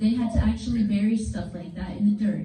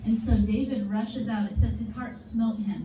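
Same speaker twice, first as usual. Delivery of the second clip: usual speaking voice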